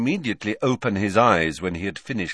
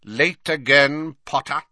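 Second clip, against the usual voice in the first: cold; 6 semitones higher, 5 dB louder than usual. A narrator's reading. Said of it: "said Snape coldly".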